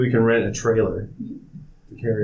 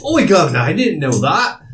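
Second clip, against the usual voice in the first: high, loud, hammed-up British accent; bad British accent; 6 semitones higher, 5 dB louder than usual. Player feigns surprise at being in England, bad British accent. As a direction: put on a badly faked British accent, pitched up. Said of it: "that's a bad accent".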